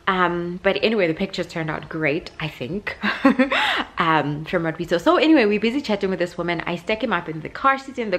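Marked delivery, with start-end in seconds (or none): 3.0-3.9 high burst of laughter; a quick amused laugh; short laugh. Real, not acted. Mom laughing while unsure about the photos, high laugh.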